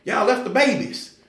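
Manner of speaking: high, loud indignant outcry; an angry woman calling out the leaders for leaving; mad lady. Retold or imitated. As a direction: scold the leaders like a furious churchgoer. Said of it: "she stood up, she was mad".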